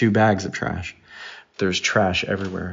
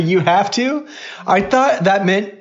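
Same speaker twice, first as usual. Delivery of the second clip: high, amused disbelief; incredulous; 11 semitones higher, 3 dB louder, slower than usual. Real, not acted. Dad hearing the cheek kiss is compulsory, incredulous. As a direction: pitch leaps up in affronted astonishment. Dad's disbelief at daughter's answer.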